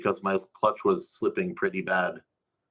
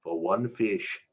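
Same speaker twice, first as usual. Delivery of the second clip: slow, soft "For one fish" landing on one; slow confirmation; 4 dB quieter, slower than usual. Acted. Narrator confirms to the kid, slow and soft. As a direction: let it sink in.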